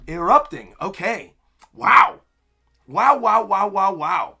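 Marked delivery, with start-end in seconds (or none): 1.7-4.4 loud burst of repeated wows; amazed. Real, not acted. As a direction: exclaim in real astonishment, louder than usual. Amazed at the giant sword.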